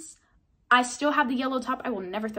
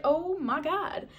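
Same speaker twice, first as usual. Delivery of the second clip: excited gasp; 6 semitones higher, slower than usual. Retold or imitated. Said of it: "I was so excited".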